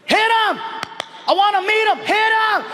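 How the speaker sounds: high-pitched, urgent yelled plea; yelling. Retold or imitated. Eager fan urging on the star's handler, yelling. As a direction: yell it out in short, high bursts. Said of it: "So I yell out to the handler".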